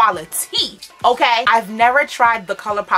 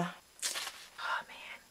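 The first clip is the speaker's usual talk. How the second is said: breathy whisper, much softer; whispered dismay; 2 semitones lower, 21 dB quieter than usual. Real, not acted. Vlogger deflated by the broken mirror, whispered. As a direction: sigh it under your breath. Disappointed aside.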